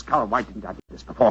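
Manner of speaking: ordinary talk, nothing marked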